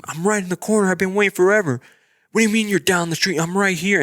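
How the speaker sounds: high, insistent mock yell, not full volume; retold yelling. Stranded rider yelling at the Uber driver, held-back yell. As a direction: re-enact yelling into a phone, pitched well up. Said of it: "Like, yelling".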